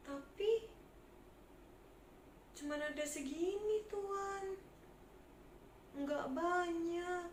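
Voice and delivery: high, soft begging voice; poor market trader begging the tax collector; timid pleading trader. Acted. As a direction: shrink away and plead for mercy.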